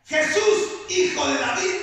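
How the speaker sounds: loud pleading cry to Jesus. Retold or imitated. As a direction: call out high and loud, begging. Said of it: "empezó a dar voces y decía". (grito fuerte de súplica)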